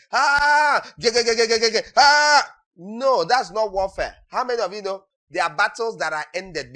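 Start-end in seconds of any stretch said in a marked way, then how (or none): 0.1-2.5 loud high war cry with gun noises; mock battlefield yell and gunfire noises; battle shout. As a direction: charge in yelling and shooting. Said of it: "on the battlefield shouting".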